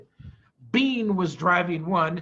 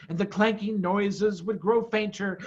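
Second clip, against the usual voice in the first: usual speaking voice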